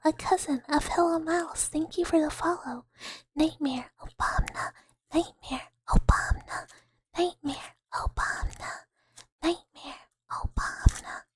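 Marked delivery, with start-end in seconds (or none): 3.3-11.2 repeated whisper. Shown as a demonstration. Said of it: "people just like hearing stuff like".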